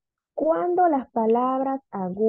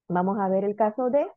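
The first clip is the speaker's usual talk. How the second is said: usual speaking voice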